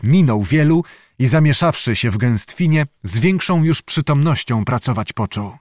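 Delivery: plain reading voice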